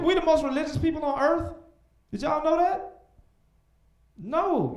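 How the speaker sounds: ordinary talk, nothing marked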